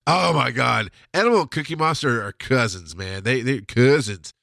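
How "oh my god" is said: ordinary delivery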